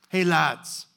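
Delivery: a lightly voiced casual, friendly call to the boys; folksy greeting. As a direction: hail them from the shore like old mates, easygoing. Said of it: "It's very, very folksy".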